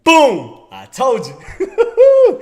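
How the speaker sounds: loud boom, then a high, drawn-out "told you"; gloating excitement. Real, not acted. Trader crowing to viewers that the call came true, gloating. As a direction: crow it out with a laugh.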